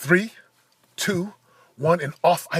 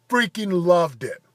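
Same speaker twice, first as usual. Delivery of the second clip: usual speaking voice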